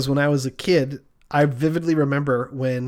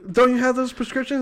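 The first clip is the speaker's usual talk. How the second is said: high, insistent question; frantic questioning; 10 semitones higher, 3 dB louder than usual. Acted. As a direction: insist in acted panic, pitched way up. Man presses druggist in panic.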